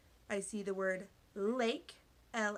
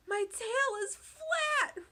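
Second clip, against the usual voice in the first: high, slow wail; 7 dB louder, slower than usual. A narrator's reading. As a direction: wail it out, much higher and louder. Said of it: "Ray wailed".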